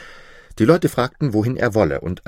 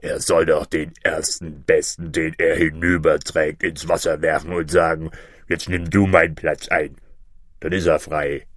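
low monster bird voice; deep, impatient creature; scornful griffin; 5 semitones lower than usual. Acted. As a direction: hand down the answer from deep below. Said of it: "rief der Vogel Greif".

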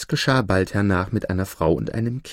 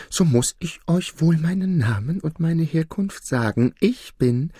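high-pitched devil voice; 7 semitones higher than usual. Acted. Devil about to name himself to the judge, high-voiced. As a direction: build up to a reveal, pitched high.

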